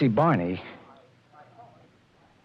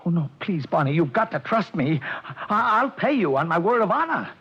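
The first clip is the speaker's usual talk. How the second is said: high, begging voice climbing higher; desperate pleading; 4 semitones higher than usual. Acted. Nervous client begs Barney to take his word, pitched up. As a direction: beg him to trust you, near panic.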